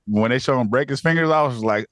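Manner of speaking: ordinary talk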